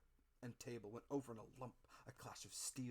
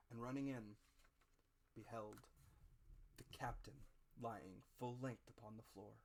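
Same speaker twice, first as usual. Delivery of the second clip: ordinary delivery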